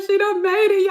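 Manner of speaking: high, loud, proud cry; tearful cheer. Retold or imitated. Fan cheering the actress on, high and loud. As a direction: shout it like your baby just flew. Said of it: "I was like".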